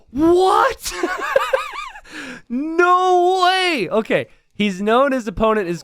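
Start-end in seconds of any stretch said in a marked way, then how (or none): 0.1-3.9 gasp, high loud what, laughing shout; stunned laughing cry of no way; shocked gasp. Real, not acted. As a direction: explode with shock. Caster shocked.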